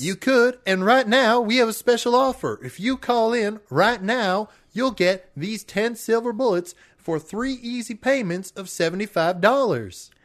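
home shopping host. Pretend. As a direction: push the special offer. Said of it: "sent us a recording".

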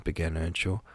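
ordinary delivery

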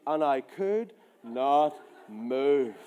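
low, heavily drawn-out words; deadpan, dragging low delivery; frozen slow. Retold or imitated. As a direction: stretch each word as if stuck fast. Acts out being frozen on the spot.

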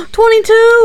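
high shocked exclamation. Real, not acted. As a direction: cry it out in disbelief. Shocked at young death.

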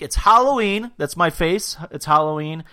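loud, lifted, punchy enthusiasm; excited hype. Real, not acted. Host naming Halloween face of the week, loud excitement. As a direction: hype it up like a fan cheering.

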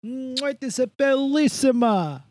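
put-on high, slow hammy Italian accent. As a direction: kiss the fingers, Italian host. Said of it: "as Mister Del Rossi would say".

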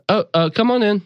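a friendly sister calling from inside; sister's voice. Acted. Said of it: "the familiar voice of your sister say".

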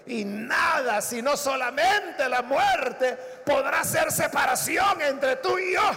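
loud, slow climactic vow; rising, loud pledge of loyalty; fervent vow. Acted. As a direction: swear it with everything.

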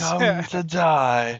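high, very slow, menacing villain voice; mock film villain. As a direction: draw out the threat theatrically. Playing a Harry Potter villain.

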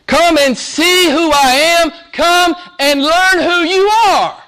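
high, loud, drawn-out preaching cry; full-force preaching. Acted. Preacher crying out the disciples' longing to the church, high and loud. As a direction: lift the congregation, stretching each phrase.

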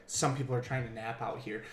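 low, very soft complaint; hushed mock grievance; imitated complaining lady. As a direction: grumble quietly, imitating an old lady. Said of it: "And she's like".

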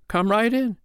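very high, singsong invitation; high storybook woman's voice; unruffled foster mother. Acted. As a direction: pipe it up sweetly and politely. Foster mother invites giant inside.

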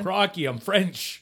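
put-on slow, loud botched French-Australian accent. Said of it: "He's French Australian".

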